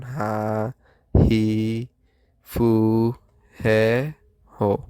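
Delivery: হা হি ফু হে হো spaced and slow; syllable list. Shown as a demonstration. Teacher listing the five kana of the row. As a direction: count out each syllable clearly. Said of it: "total পাঁচটা".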